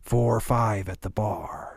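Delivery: usual speaking voice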